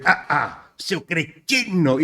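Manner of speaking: insulto imitado, bem arrastado; xingamento indignado. Retold or imitated. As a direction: xingue esticando muito as palavras, mais agudo. Ator descobre a pegadinha, xinga. (imitando ofendido, palavras alongadas)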